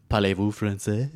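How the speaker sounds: put-on slow, soft French accent. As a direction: ask it like a Frenchman, slowly and quietly. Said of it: "Do a French".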